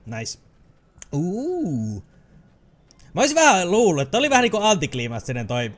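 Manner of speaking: ordinary talk, nothing marked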